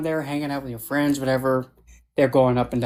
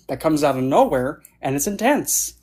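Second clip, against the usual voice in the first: normal talking voice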